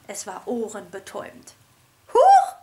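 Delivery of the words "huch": high, loud startled coo; startled high trill; surprised high lady. A narrator's reading. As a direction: gasp it like a fluttery teacher. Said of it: "fragte sie den Kakadu".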